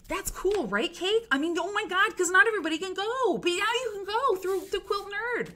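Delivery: high, loud excited gush; overflowing with joy; squealing with delight to Kate; gushing excitement. Real, not acted. Gushing to co-host.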